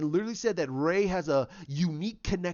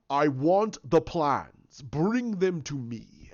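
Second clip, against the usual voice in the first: Vader yelling; 3 semitones lower, much slower than usual. Retold or imitated. Vader yells at the crew for the plans, slow and menacing. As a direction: drag each word out like a menacing Vader. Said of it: "he's sitting there yelling".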